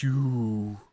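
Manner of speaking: very slow, soft, hollow ghost; eerie slow ghostly whisper-voice; haunting ghost voice. Acted. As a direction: draw it out like a spirit haunting them. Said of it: "he says".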